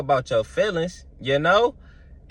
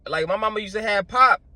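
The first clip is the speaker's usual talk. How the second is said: usual speaking voice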